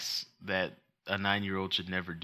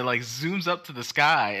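ordinary talk, nothing marked

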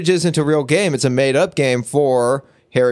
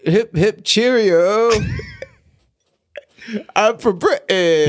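higher, hammed-up English accent; 6 semitones higher, slower than usual. Cohost given English in accent challenge, mock British accent.

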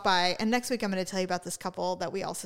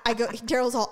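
ordinary talk, nothing marked

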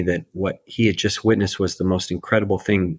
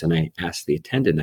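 ordinary talk, nothing marked

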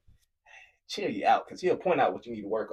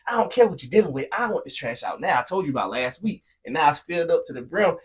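loud, much higher, heated rant; angry rant; 9 semitones higher, 7 dB louder than usual. Retold or imitated. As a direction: tear into him about the overflowing trash. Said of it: "not having patience".

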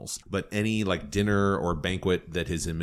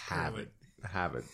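mock Boston accent; 4 semitones lower, 8 dB quieter than usual. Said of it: "in a Boston accent".

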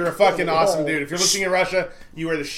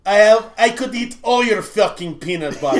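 put-on higher, louder Russian accent; indignant Russian roommate; 4 semitones higher, 6 dB louder than usual. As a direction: boast in a hammed-up Russian accent. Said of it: "And he goes".

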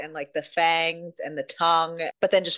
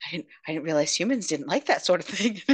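puzzled alien; 4 semitones lower, faster than usual. Retold or imitated. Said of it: "So he's like".

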